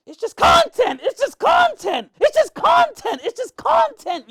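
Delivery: mocking sing-song, high and loud, repeated with rising pitch; mock repeated excuse. Mocking a rival's excuse to his listeners, high and loud. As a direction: throw his excuse back in his face, mockingly.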